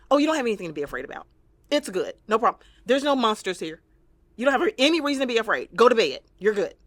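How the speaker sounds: mock parent at bedtime; imitating a parent. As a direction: rush the child off to bed. Parent dismissing child's fear.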